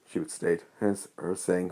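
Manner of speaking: ordinary delivery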